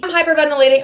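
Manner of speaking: ordinary talk, nothing marked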